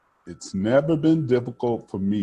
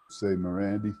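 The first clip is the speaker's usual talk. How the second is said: usual speaking voice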